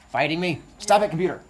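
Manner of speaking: loud, annoyed scolding; scolding the computer. Real, not acted. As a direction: tell the computer off loudly.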